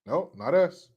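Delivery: normal talking voice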